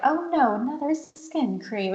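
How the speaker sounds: high, breathy-free mock celebrity sigh, slow at the end; a mock Nicole Kidman ad line; Nicole Kidman impression. Podcast host mocks a skin cream ad, lightly voiced. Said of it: "And she's talking like that".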